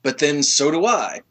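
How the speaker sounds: ordinary talk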